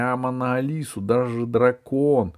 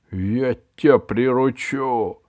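slow, louder dragon growl; dragon bark; 5 semitones higher, 5 dB louder, slower than usual. A narrator's reading. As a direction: growl it at the boy. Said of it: "рявкнул дракон".

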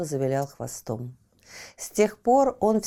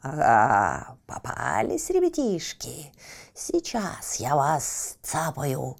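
low, slow gloating predator; menacing villain bird; evil hawk voice; 4 semitones lower, slower than usual. Acted. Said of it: "злобно захохотал". (злобный ястреб)

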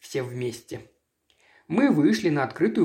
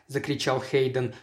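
ordinary delivery

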